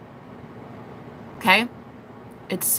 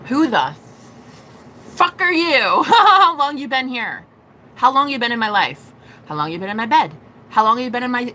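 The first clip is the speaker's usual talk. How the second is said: hammed-up indignation with a laugh breaking in; pissed off; 4 semitones higher, 3 dB louder than usual. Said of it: "They get hella pissed".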